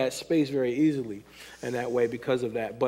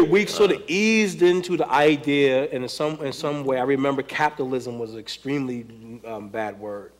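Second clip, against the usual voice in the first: ordinary talk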